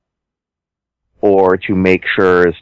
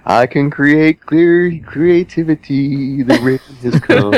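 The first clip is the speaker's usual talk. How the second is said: grand god voice; 8 semitones higher than usual. Pretend. GM mock godlike voice.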